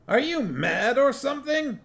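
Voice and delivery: a high voice for the dwarf Cuddy, alarmed. A narrator's reading. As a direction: squeak it out like a startled dwarf.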